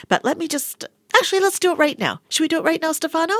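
ordinary talk

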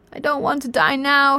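high-pitched, mildly frantic wail; yelled protest. A narrator's reading. Arthur facing being thrown into space, frantic mild yell. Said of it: "he yelled".

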